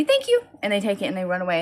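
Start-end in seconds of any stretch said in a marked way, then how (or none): 0.0-0.4 put-on very high, bright little girl thanks; little girl thank you. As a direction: chirp it like a small girl. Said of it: "they say".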